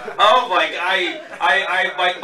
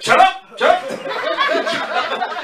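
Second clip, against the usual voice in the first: laughing higher shut up; mock shout at dad; 11 semitones higher, much slower than usual. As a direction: shout it like an embarrassed son. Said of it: "the son having to say".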